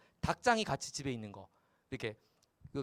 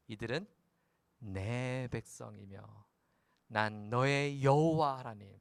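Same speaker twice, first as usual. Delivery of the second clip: low, slow and soft, fatherly; a loving claim, unhurried; tender God; 6 semitones lower, 3 dB quieter, slower than usual. Acted. God tenderly calls Israel his people, slow and soft.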